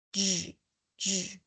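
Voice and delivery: soft buzzing zh, said very quietly; quiet zh sound. Shown as a demonstration. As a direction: buzz the zh sound softly. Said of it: "I will show each phonetic symbol".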